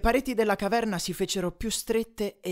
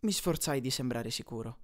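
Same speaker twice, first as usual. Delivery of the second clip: ordinary delivery, nothing marked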